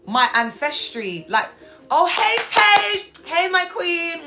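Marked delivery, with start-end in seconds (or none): 1.9-4.2 high, slow squeal of hello; loud, high, delighted welcome; excited greeting. Real, not acted. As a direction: greet your favourite friend in a drawn-out squeal. Greets a friend joining the stream.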